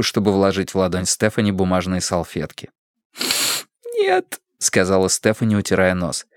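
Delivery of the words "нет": very high, weepy little answer; small sniffly voice, pitched way up; tearful. A narrator's reading. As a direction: squeak it out while still crying. Stephanie crying, wiping her nose.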